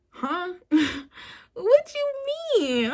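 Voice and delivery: high-pitched, drawn-out "what you mean"; incredulous. Retold or imitated. Woman replaying her reply to the breakup text, incredulous. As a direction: squeal it in mock disbelief.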